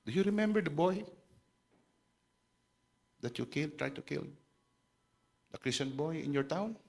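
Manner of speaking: hushed, lightly emotional quote; imitated tearful man, gently asking; retold tearful question. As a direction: softly voice a man moved to tears. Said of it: "Umiyak yung lalaking pilay".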